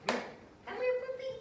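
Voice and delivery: very high, loud sing-song call to the dog; high puppy call. Real, not acted. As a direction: squeal it out to coax a puppy. Calling the puppy over.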